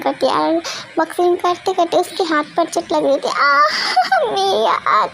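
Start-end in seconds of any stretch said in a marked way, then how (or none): 3.3-5.1 high yelp of pain with a laugh; pained cry. Acted. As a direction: cry out at a hurt hand. Hurt hand boxing. (हाथ में चोट से चीखना)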